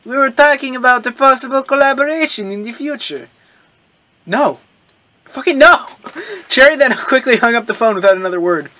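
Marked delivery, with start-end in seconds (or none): 0.0-3.3 put-on French accent. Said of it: "He is French". 4.2-5.9 loud, high furious no; angry refusal. Acted. Character refusing angrily.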